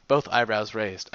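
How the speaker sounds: ordinary delivery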